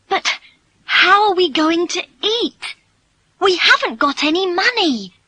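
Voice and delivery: high, worried pleading; alarmed, high-voiced girl; anxious protest. Daughter protests to father about money.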